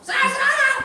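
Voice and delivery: very high, loud strained yell; hammed-up shouting from the pulpit; mock screaming preacher. Preacher imitates a colleague who yells through sermons, screaming. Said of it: "dia teriak-teriak terus".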